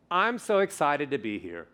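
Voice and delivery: "I'm so excited to be here" sliding steadily down; descending melody. Shown as a demonstration. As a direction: let it run out of gas toward "here". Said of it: "going from a high note to a lower note".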